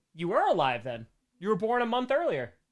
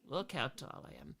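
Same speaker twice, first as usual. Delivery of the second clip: very soft, small voice; hushed repeat; 5 semitones lower, 15 dB quieter than usual. Retold or imitated. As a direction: say it under your breath.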